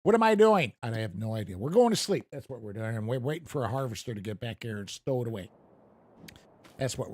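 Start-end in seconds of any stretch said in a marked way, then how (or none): none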